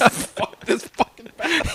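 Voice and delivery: high, slow hammed-up grumble through laughter; hammed-up rage at a bat; mock fuming. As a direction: play a cartoonish tantrum. Acting out angry stomping.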